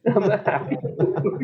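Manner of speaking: high, breaking into laughter; giggling through the joke; laughing repeat. Real, not acted. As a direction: say it again while cracking up.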